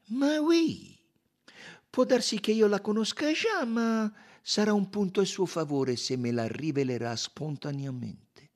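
high, persuasive Poirot; Poirot, coaxing. A narrator's reading. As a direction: start high and loud, then ease down.

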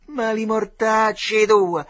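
high, loud, slow cursing yell; bully yelling a curse at the boy; angry shout. A narrator's reading. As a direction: hurl the curse while kicking. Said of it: "gli gridò lo Sgarone".

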